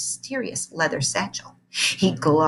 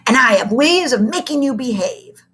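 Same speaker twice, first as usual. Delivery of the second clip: 1 menacing threat; 6 semitones higher, 6 dB louder than usual. A narrator's reading.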